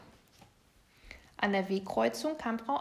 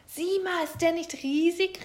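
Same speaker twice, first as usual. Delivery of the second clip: high, louder thrilled call; excited; 7 semitones higher, 5 dB louder than usual. A narrator's reading. Other little bear showing his huge salmon, excited. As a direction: call out delighted at your catch. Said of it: "rief der andere Bär begeistert".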